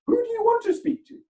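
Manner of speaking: high, loud put-on receptionist voice; hammed-up snippy receptionist; mock rude receptionist. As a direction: throw the question back rudely.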